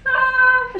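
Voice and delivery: high, drawn-out "ah"; high startled cry. Real, not acted. Reacting to the chilli shot challenge.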